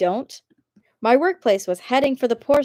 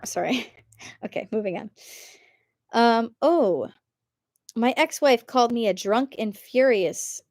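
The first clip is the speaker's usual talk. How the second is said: normal talking voice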